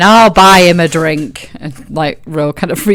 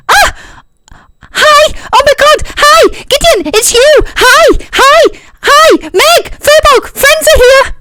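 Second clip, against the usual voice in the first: very high, loud, gushing greetings; excited Tyne; 5 dB louder, slower than usual. Acted. Tyne spots his friends and squeals with joy. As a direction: squeal hello at his friends like an overexcited puppy. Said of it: "And he's like".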